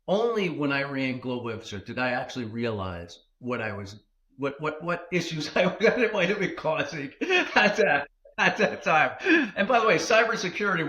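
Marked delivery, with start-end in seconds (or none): 4.6-10.9 laughing sheepishly, a bit louder. Real, not acted. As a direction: chuckle through the confession. Laughing at own past trouble.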